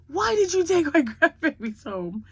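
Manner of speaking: high, loud hammed-up demand, laughing through it; retold loud complaint. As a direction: play the outraged grandma, loud and high. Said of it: "I go".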